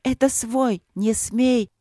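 high, desperate pleading shout; desperate cry. Acted. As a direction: shout it in despair. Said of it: "отчаянно закричала Василина".

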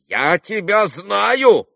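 high, loud, drawn-out accusation; irritated exclamation. A narrator's reading. Agitated stranger threatening Kolya, exclaiming irritably. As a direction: cry out slowly in irritation. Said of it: "восклицал он раздражённо".

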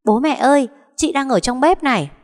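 put-on high, loud child calling parents; calling out loudly. A narrator's reading. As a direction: shout the news out like an excited little boy. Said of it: "chạy ra nói lớn".

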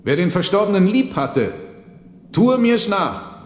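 a high, loud toast; called out toast. A narrator's reading. Monk raises his wine glass to the dead son, calling loudly. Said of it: "rief er".

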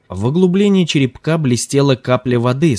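usual speaking voice

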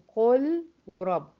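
قل ورب slow, with a clear L before the R; separated as written. Shown as a demonstration. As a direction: keep the L of قل apart from رب. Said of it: "لكن بتتكتب".

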